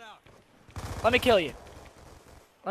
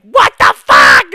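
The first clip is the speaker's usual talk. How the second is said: imitating a kid's loud raging yell; mock kid yell; 17 dB louder, slower than usual. Streamer imitates a raging kid yelling after getting kills. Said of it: "at the top of his lungs".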